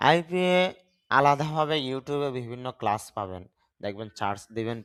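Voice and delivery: normal talking voice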